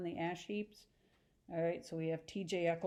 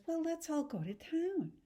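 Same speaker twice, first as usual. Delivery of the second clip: much higher, airy Daisy voice; Daisy's bright deflection; 9 semitones higher than usual. Acted. Daisy to the uncomfortable room, high and breezy. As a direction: sweep everyone out of the awkward moment.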